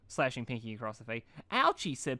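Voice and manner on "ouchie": cute ouch. A narrator's reading. Pinkie struck, cute cry.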